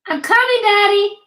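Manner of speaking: put-on high child's voice calling out; a girl answering her dad from upstairs; child yelling back. A narrator's reading. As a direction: shout it down the stairs like a little girl. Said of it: "Amira yelled from the top of the stairs".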